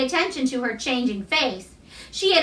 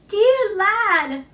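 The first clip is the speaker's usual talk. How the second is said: tremulous; 7 semitones higher than usual. A narrator's reading. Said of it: "she broke out tremulously".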